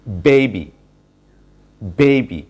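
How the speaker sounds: Baby with BAY stressed and the ay long; baby punched on the first syllable; teacher modelling baby with a stressed first syllable. Shown as a demonstration. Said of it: "বিতে কিন্তু stress দেয়া আছে".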